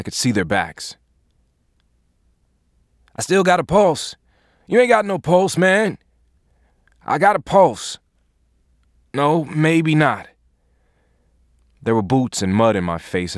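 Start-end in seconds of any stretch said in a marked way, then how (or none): 3.1-4.2 high, loud call over the rotor noise; urgent shout. Acted. Medics arguing over chopper noise. 4.7-6.0 high, loud contradicting call; shouted rebuttal. Acted. Second medic shouting back. 7.0-8.0 high, loud call over the rotor noise; urgent shout. Acted. First medic insisting. 9.1-10.3 slow call. Acted. Second medic conceding.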